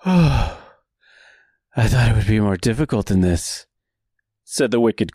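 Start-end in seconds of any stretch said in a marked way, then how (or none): 0.0-3.7 breathless villain line; panting between words; short of breath. Acted. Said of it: "short of breath".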